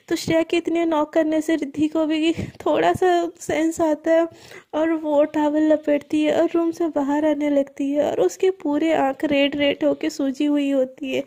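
ordinary delivery, nothing marked

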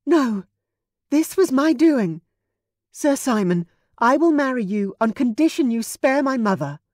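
sharp cry of refusal; a loud, desperate no; cried out. A narrator's reading. Daughter cries out against the marriage, loud and desperate. Hannah offers herself to save mother.

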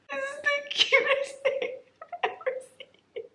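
squealing high through a laugh, trailing into a breathless whisper. Real, not acted. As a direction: overcome with joy, laughing it out. Overwhelmed by a gift.

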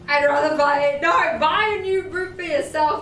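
ordinary talk

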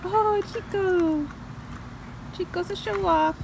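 high sing-song voice cooing to the birds. Real, not acted. Owner cooing at her parrots on their swing, high sing-song baby talk.

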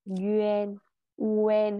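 "Yuen, Uen" with rounded lips. Shown as a demonstration. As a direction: keep the mouth round through "Yuen, Uen". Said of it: "mình tròn miệng lên".

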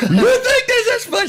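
very high, laughing wife imitation; wife's voice. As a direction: squeak it out like an upset wife. Imitating his wife on the phone.

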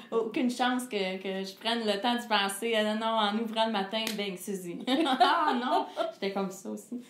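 laughing through the memory, rushing some words; laughing recollection. Real, not acted. Singer laughing as she recalls being asked first. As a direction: laugh while recounting being put on the spot.

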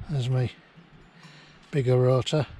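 normal talking voice

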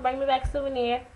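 ordinary talk

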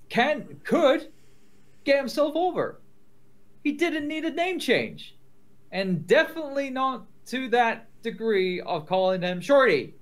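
high-pitched, indignant protest; exasperated rant. Real, not acted. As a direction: hammer out each phrase in high-pitched outrage. Ranting over the name change.

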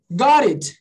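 "Got it" linked, T tapped like "gorit"; R sound in "Got it". Shown as a demonstration. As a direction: tap the T of "Got it". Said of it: "olha como é que eu falo". ("Got it" com T soando como R)

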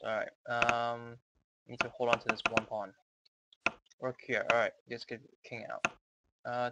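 ordinary delivery

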